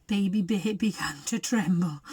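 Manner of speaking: ordinary delivery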